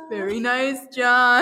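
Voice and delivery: loud, laughing cheer on John; mock cheer. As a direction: shout it like a fan at the TV. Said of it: "Harley said that every time".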